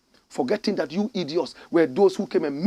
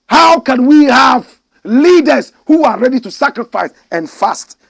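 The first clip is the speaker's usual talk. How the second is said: preaching shout; 8 semitones higher, 12 dB louder than usual. Real, not acted. Preacher's rising rhetorical question.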